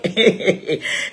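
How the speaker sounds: low, soft chuckle; short amused laugh under the breath. Real, not acted. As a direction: let out a small knowing laugh. Chuckles after dismissing the gossip.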